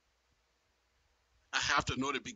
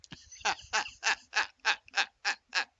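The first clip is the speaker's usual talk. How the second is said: laugh; 4 semitones higher than usual. Real, not acted.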